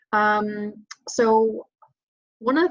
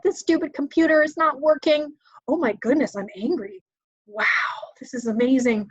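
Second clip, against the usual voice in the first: mock frustration; 5 semitones higher than usual. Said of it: "That was my anger phase".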